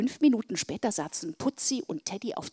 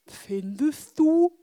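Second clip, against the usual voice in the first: doubtful; 5 semitones higher, 6 dB louder, much slower than usual. A narrator's reading. Teddy doubtful.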